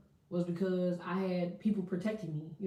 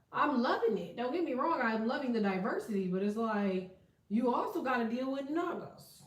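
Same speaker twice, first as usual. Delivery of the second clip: usual speaking voice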